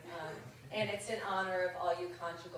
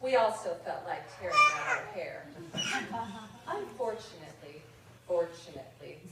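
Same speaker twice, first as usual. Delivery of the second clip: deadpan mock-formal letter read in verse; reading a mock rejection letter poem over audience laughter; comic poem reading; 11 dB louder than usual. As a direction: read it like a snooty editor's form letter.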